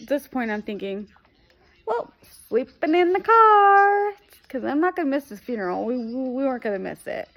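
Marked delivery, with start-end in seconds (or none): 1.9-5.8 mock resigned announcement. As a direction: belt it out high, stretching the words in mock resignation. Acted resolve after unaffordable room.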